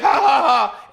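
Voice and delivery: high, loud mock laugh. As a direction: laugh at him like a smug jerk. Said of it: "He's like".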